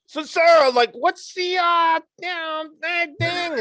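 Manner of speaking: high, loud, frantic cabbie voice; hammed-up harried New Yorker; New York cab driver. Colleague mocks a rushed New York taxi driver sales call. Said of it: "versus the New York City taxi cab driver".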